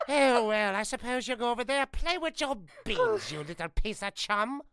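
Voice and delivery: high, loud, snooty prim voice; prim and proper arrogant villain; Vile voice. Acted. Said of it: "Vile is so easy".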